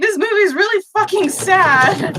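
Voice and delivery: high-pitched retold wail; mock tearful. Answering mom in tears over a movie, mock tearful. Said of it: "tears streaming down my face".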